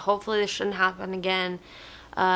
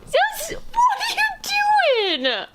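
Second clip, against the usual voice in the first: much higher laughing shout; laughing squeal; 3 dB louder, much slower than usual. Real, not acted. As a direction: squeal through laughter at the dog. Dog jumps on her.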